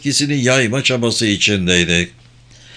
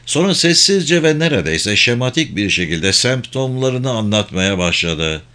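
usual speaking voice

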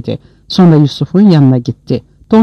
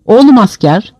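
raised call to the soldiers; shouted order; 7 semitones higher, 4 dB louder than usual. A narrator's reading. Officer shouting orders at the soldiers, loud raised call. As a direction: call out to the troops. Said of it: "diye bağırdı".

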